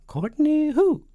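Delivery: very high, loud, drawn-out falsetto. A narrator's reading. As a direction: stretch out the question in falsetto. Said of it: "and a falsetto voice".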